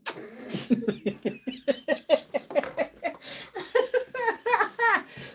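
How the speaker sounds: loud, high burst of laughter. Real, not acted. Laughing after calling out to a viewer. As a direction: laugh at her own call.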